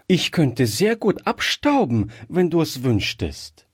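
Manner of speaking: put-on high little girl's voice; eager child. A narrator's reading. Gretchen offering to dust for the old man, high child voice. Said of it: "entgegnete das Kind".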